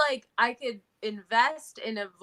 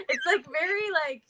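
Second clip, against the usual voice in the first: ordinary talk, nothing marked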